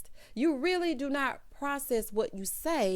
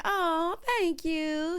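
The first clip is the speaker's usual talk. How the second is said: high, mock-sweet thank you; imitated coy bride; 8 semitones higher, much slower than usual. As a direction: mimic a flattered bride, pitched up. Voicing bride's reply to compliment.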